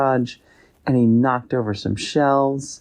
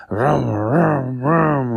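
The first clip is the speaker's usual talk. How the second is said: engine vroom noises; 4 semitones higher, much slower than usual. Acted. Boy making engine noises on bike.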